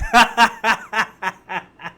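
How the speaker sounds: a loud real laugh; short burst of laughter. Real, not acted. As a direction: laugh out at your own joke. Laughs after "she ate.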